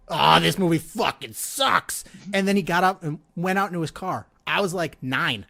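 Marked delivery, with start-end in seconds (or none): 0.1-2.0 slow, drawn-out mock heckle; loud heckle. Dad loudly heckling ten minutes into the movie, mock yell. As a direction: imitate a loud complaint, stretching each word. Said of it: "just as loud as he could".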